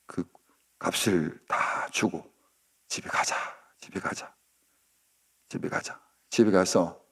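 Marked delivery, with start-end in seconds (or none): 2.9-6.0 soft whispered pleading; hushed coaxing. Acted. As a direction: gently urge her home under the breath. 호세아가 고멜을 데려가려 달램. (속삭이듯 달래는 소리)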